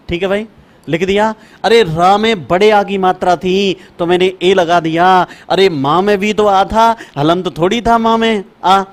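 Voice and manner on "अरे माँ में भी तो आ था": usual speaking voice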